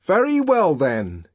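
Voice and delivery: grim, forceful concession; grim resolve. A narrator's reading. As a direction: grimly accept their silence. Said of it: "he went on grimly".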